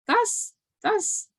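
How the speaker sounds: high, stammering disbelief; mock incredulous sputter. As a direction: sputter like it's absurd. Acted disbelief in a quote.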